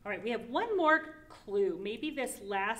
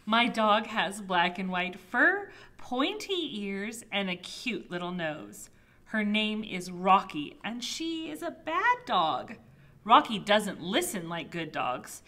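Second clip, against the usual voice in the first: animated storybook narration, loud opening stresses; reading to children; 8 dB louder than usual. Acted. Child narrator describing a pet, animated storybook reading. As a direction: read it out with big swings in pitch. Reads picture book aloud.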